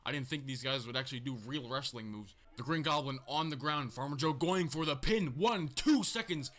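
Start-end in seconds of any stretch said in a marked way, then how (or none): 5.4-6.5 tense pin count. Real, not acted.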